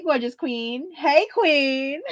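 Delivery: normal talking voice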